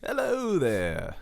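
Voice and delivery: slow, drawn-out announcer hello; radio announcer. Shown as a demonstration. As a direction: hold the hello like a radio host. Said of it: "I'm gonna speak like a baseball announcer".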